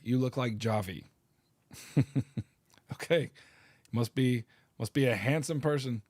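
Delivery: usual speaking voice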